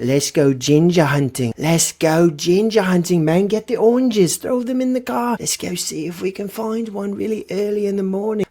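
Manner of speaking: high, mock UK lad rallying a buddy; British ginger hunter. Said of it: "with his buddy and be like".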